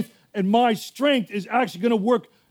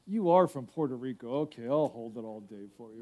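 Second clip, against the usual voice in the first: much softer, lower meek voice; a meek congregant giving in to the pastor, very soft; meek mock reply; 10 semitones lower, 10 dB quieter than usual. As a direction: mumble a meek yes.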